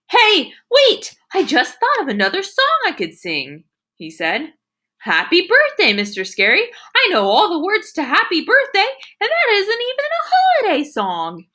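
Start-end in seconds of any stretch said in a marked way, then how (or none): 0.1-3.6 high loud sudden idea; excited shout. Acted. 5.0-11.4 put-on high eager little boy; excited boy. Sheldon eagerly proposing.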